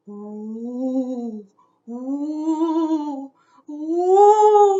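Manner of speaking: rising, swelling ghostly oohs; spooky ghost ooh. Acted. Ghostlings practising spooky noises, growing to a loud ooh. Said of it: "One last round of spooky noises".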